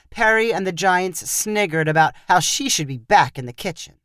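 usual speaking voice

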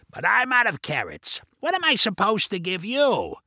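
put-on raspy Carrot Man voice. A narrator's reading. Vinny teasing the kids about carrots, raspy voice. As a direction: rasp it out as a playful question. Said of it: "replied Vinny in the raspy voice".

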